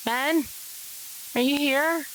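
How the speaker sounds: high-pitched, questioning call. Acted. Woman searching her boyfriend's house, calling out. Character calls for Ren in his house.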